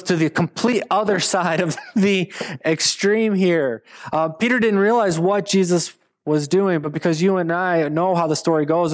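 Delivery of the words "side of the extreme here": ordinary talk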